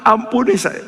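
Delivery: high mock wail for mercy; pleading cry. Sinners in hell begging God, high mock pleading. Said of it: "di neraka teriak-teriak".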